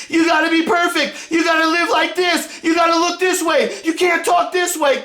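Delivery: high, yelled mocking of the rules; mocking shout. Mocking the Democrats' demands, shouted high. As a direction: spit their demands back in scorn. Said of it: "Democrats wanna fucking start telling everybody".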